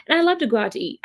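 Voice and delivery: ordinary talk, nothing marked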